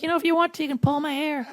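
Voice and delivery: high breathy-free squeaky woman voice, way up; hammed-up high female voice; mock girly voice. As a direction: squeak it up like a coy girl. Girlfriend voiced in bedroom story.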